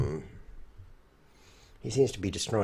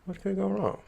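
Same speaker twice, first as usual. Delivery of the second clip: ironic, higher; 9 semitones higher than usual. Acted. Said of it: "repeated higher ironic take".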